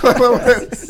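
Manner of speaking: playful shocked outburst; mock outrage. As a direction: yelp it like you've been betrayed. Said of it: "Like, "Hey".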